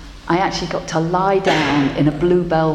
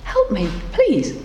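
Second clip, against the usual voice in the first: gentle plea; 5 semitones higher than usual. A narrator's reading. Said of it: "she heard the voice again".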